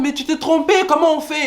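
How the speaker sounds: high, anxious retold plea; panicked disbelief. Nephew telling uncle he got the number wrong, high alarmed. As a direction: sound lost and alarmed. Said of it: "Je suis en mode".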